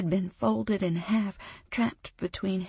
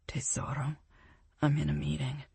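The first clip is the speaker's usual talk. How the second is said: low, soft murmur; hushed; 4 semitones lower, 5 dB quieter, faster than usual. Acted. Boyfriend answering quietly from a meeting, hushed. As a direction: keep it down so the room can't hear. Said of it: "he says in a hushed voice".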